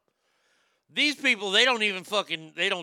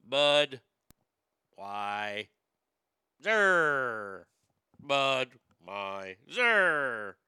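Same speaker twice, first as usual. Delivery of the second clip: deep croaky frog voices, split over three frogs; Bud, why, Zer croaked low like the Budweiser frogs; croaking frogs; 8 semitones lower, much slower than usual. Retold or imitated. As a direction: croak each syllable like a frog on a lily pad. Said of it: "three frogs were sitting on lily pads".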